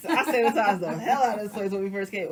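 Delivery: half-laughing while talking. Real, not acted. Host joking about cleaning the set, laughing.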